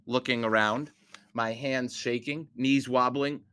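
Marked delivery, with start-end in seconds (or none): none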